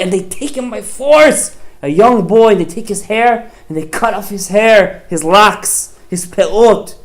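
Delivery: high, loud climactic telling; rising emotional preaching. A narrator's reading. Preacher telling Yosef's sale, high and loud with passion.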